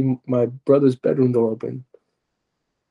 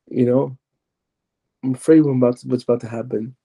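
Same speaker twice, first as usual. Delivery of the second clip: ordinary talk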